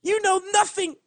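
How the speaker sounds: high, loud, breaking cry. A narrator's reading. Twilight cries out at the guard in grief, breaking shout. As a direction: shout it through tears as Twilight. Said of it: "Her voice broke".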